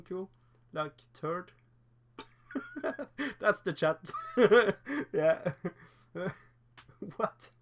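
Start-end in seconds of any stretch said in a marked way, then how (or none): 2.5-3.4 real high-pitched giggle; amused laugh. Real, not acted. Reacting to a chat comment. 4.0-5.1 real loud, high laugh; amused laugh. Real, not acted. Still amused by the chat.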